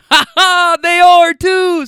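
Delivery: high, loud imitation of a friend cracking up; mock guffaw. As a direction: burst out laughing, loud and drawn out. Acting friend one's laughing outburst.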